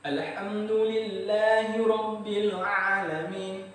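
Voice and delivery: slow, chanted tajwid recitation; Quran recitation. Shown as a demonstration. Teacher demonstrating the ain sound, slow chanted recitation. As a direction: recite it as a model reading.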